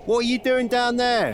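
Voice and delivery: high, loud call downward; shouting down a pit. Acted. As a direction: yell it down into the hole. Calling down into pit.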